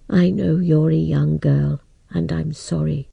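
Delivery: a sorry old man breaking bad news. Old man explains to Hummingbird.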